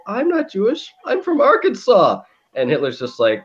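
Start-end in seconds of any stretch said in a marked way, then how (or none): 0.1-2.2 put-on high protesting kid voice; scared kid. Kid protesting to Hitler, high pleading voice. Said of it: "he's like".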